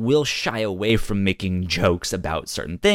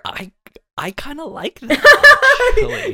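giggly, much higher and louder; laughing admission; 13 dB louder than usual. Real, not acted. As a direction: admit it sheepishly through laughter. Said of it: "listener laughs".

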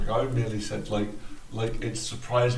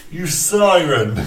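laughing; 6 semitones higher, 10 dB louder, much slower than usual. Real, not acted. Teasing friend after time runs out.

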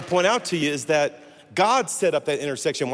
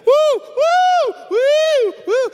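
loud, high party whoops; whooping party girl; 7 dB louder, much slower than usual. Retold or imitated. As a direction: whoop it up like a tipsy girl coming aboard.

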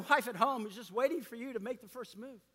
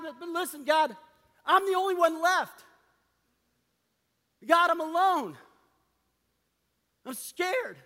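higher and louder, a frightened plea to God; scared pleading; 7 semitones higher, 4 dB louder than usual. Acted. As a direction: voice Elijah begging, alone and scared. Elijah pleads with God, afraid.

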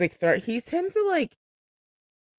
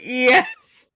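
excited yes; 8 semitones higher, 5 dB louder than usual. Retold or imitated. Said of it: "I was like".

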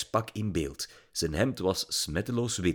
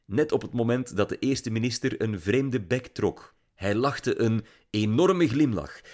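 ordinary delivery, nothing marked

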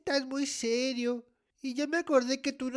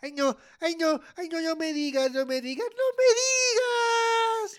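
pleading that climbs into a high wail; building dread; 5 semitones higher than usual. Acted. Child guesses the banquet is off, dread building to wail.

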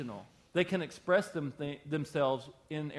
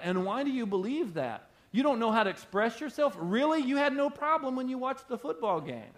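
sarcastic, higher and louder; mock their excuse from a higher pitch; high challenging preaching; 9 semitones higher, 3 dB louder than usual. Real, not acted.

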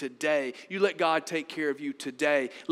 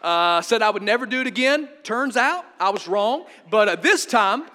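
loud, higher-pitched sheepish excuse to God; mock bargaining prayer; 4 semitones higher, 9 dB louder than usual. Sinner asking God for pardon once more, mock bargaining. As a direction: haggle loudly, stumbling over the uhs. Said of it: "Many of us are good at praying this".

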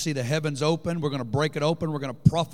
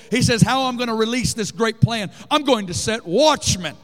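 louder, higher, fervent exhortation; preaching at full force; 7 semitones higher, 5 dB louder than usual. Acted. Preacher exhorts the Saturday crowd on intercession, fervent and loud. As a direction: drive every line home with fire.

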